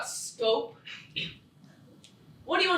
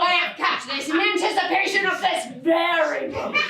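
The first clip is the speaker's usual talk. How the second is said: melodramatic mother; 4 semitones higher than usual. Acted. Mother confronting daughter, theatrical.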